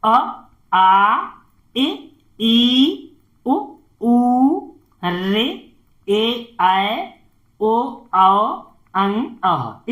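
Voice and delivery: teacher reciting Hindi vowels aloud for pupils; clear vowel drill. Shown as a demonstration. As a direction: call out every vowel distinctly like a class drill. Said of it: "ए का sound आया".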